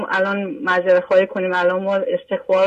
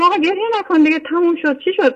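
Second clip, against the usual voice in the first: high, quick, pleading reassurance; hushing a child, pitched up and hurried; retold consoling; 9 semitones higher than usual. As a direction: coax the crying girl to stop, fast and high up. Consoling her crying daughter retold.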